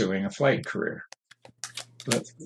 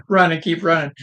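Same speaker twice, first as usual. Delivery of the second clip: urgent command; 7 semitones higher, 4 dB louder than usual. Retold or imitated. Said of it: "this is just your dream saying".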